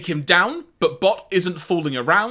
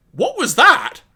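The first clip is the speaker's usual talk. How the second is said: higher, louder acted startled question; 8 semitones higher, 7 dB louder than usual. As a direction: exclaim it as a startled character would. Reads Elise's line in episode dialogue.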